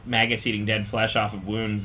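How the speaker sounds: ordinary talk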